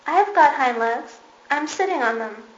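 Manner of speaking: loud, high insistence; protesting loudly. A narrator's reading. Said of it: "cried the little rabbit".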